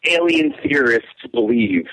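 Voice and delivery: slow, deliberate model reading; demonstrating the phrase. Shown as a demonstration. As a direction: spell the line out slowly for someone to repeat. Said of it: "Just say".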